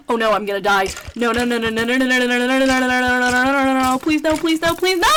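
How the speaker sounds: rising panicked pleading no; begging desperately, pitch climbing; anguished denial. Real, not acted. Character dying in the game.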